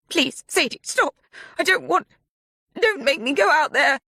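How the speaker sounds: high, pleading in panic; terrified begging. A narrator's reading. Mia begging Sadie during the struggle. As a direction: beg for her life.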